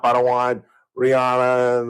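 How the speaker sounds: ordinary talk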